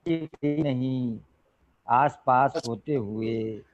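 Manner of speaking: slow couplet, falling away at phrase ends; poetry recitation. Acted. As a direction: recite slowly with long pauses between phrases. Repeats couplet at mushaira. (शेर का धीमा, ठहर-ठहर कर पाठ)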